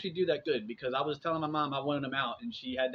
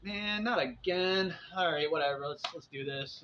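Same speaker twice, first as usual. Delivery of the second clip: weary resignation; 3 semitones higher than usual. Retold or imitated. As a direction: drag through it like another bad morning. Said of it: "You wake up and you're like".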